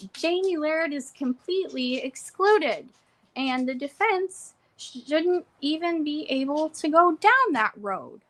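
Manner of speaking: high, put-on imitation of the judge. Retelling the judge's ruling to viewers, in a high put-on voice. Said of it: "I don't know why I did that voice ... a little bit of a higher pitch".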